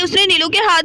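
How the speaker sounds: ordinary delivery, nothing marked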